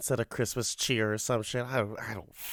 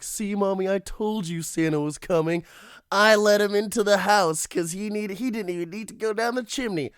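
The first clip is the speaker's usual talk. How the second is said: put-on high child voice, growing louder; imitating an excited child showing off; proud little daughter; 10 semitones higher, 5 dB louder than usual. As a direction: boast to Mommy like a little girl. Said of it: "her daughter just".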